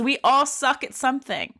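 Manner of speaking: ordinary talk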